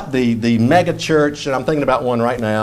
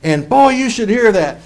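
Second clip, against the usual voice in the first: lightly voiced self-satisfied reader; a hint of smug delight; imitating believer; 6 semitones higher than usual. Preacher mimics a listener pleased with a book, lightly smug. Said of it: "you've probably heard this one".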